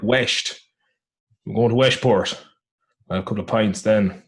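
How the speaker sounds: imitated west of Ireland speech, "West" said "Wesht"; Irish dad accent with sh for S. Shown as a demonstration. Showing the character's talk, west of Ireland accent. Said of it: "like a H just coming after the S".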